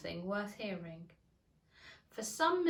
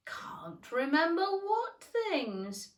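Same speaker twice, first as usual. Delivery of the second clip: slow question; 9 semitones higher, 5 dB louder, much slower than usual. Acted. Caterpillar questioning Alice.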